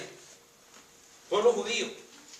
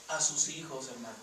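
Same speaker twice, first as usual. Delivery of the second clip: hushed; 7 semitones lower, 7 dB quieter than usual. Real, not acted. Preacher hushes at grim point.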